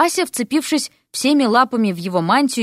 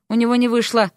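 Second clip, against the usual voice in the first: plain reading voice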